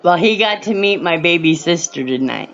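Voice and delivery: ordinary talk, nothing marked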